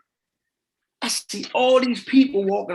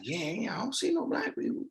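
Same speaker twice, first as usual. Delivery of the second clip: hushed agreement; 4 semitones higher, 12 dB quieter than usual. Retold or imitated. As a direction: voice dropped way down, nearly under the breath. Mother agreeing under her breath.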